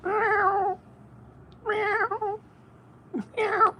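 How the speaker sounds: high soft meows; cat meow. Real, not acted. Meowing back at his cat, high and soft.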